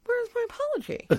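very high, squeaky plaintive wail; mock wailing demand. Wife demanding husband's apology, mock high wail. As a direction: squeal it like a hammed-up wronged wife. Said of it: "I'm, I'm going".

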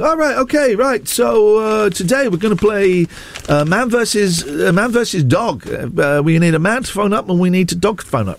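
hammed-up radio host patter; mock radio host. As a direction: send up a radio host launching a phone-in. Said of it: "one of those radio hosts that come in".

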